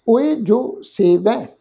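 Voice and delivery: ਓਏ ਜੋ ਸੇਵੈ drawn out slowly with a rising ਓਏ; slow recitation. Shown as a demonstration. Teacher reciting ਓਏ ਜੋ ਸੇਵੈ slowly for the congregation. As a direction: chant ਓਏ ਜੋ ਸੇਵੈ slowly, word by word. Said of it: "ਖਿਆਲ ਰੱਖੋ".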